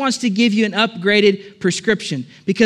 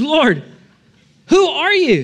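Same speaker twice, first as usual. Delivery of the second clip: much higher, louder cry of alarm on who; panicked question; 7 semitones higher, 5 dB louder, slower than usual. Acted. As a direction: blurt it out in sudden panic. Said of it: "So he says".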